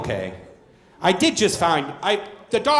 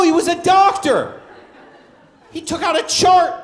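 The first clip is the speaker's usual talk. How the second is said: ordinary talk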